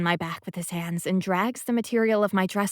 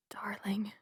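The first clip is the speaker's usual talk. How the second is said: whisper; 10 dB quieter than usual. A narrator's reading. Echoing his word, breathless.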